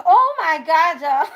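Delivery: usual speaking voice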